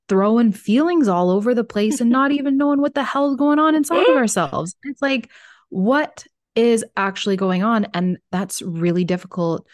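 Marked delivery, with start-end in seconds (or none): 0.1-7.9 much higher and louder, getting carried away; rising into an exasperated rant; worked-up rant. Real, not acted. Podcast host ranting about people who never check in with their feelings, exasperated and louder.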